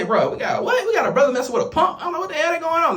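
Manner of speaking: high, laughing incredulous questions; laughing disbelief. Retold or imitated. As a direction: laugh through the shock as the voice climbs.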